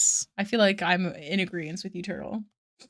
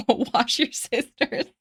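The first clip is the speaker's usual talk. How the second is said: cracking up; 4 semitones higher, much slower than usual. Real, not acted. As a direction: barely get it out for laughing. Reading chat joke, cracking up.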